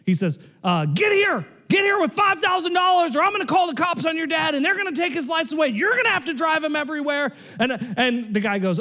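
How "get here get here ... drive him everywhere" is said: bully the son into coming; angry phone demand. Retold or imitated. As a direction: hothead shouting threats at the old man's son. Young guy yelling at son on phone.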